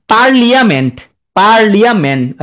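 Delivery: পার্লিয়ামেন্ট sounded as par-li-a-ment; spelling pronunciation. Shown as a demonstration. Teacher making the spelling stick, syllable-by-syllable পার্লিয়ামেন্ট. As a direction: say every written vowel in পার্লিয়ামেন্ট, li-a clearly. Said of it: "এখন ভুল হবে না আর".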